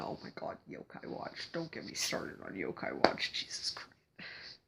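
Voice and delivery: whispered, overwhelmed fan aside; hushed raving. Real, not acted. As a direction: whisper it like you can't even begin. Gushing aside about a favorite game.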